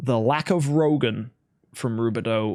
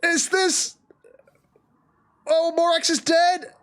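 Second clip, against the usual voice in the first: very high cry of disbelief; astonished, high-pitched call of a kill; excited play-by-play; slower than usual. Real, not acted. Caster announces a hero's death, excited. Live match commentary at climax.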